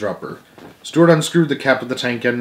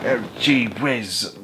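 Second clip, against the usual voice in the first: dejected; 6 dB quieter, much slower than usual. A narrator's reading. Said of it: "he kept saying".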